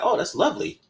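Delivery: a hint of a courteous charmer; polite charmed man. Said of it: "he was like".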